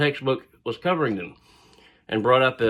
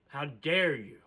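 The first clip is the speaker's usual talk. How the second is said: indignant; 2 semitones higher, 9 dB quieter than usual. Retold or imitated. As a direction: land the word dare with force. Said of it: "raging on the inside".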